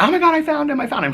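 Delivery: high-pitched, excited retold outburst; excited relief. Friend calls to say she found her dog, excited relief. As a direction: imitate a friend's thrilled phone call.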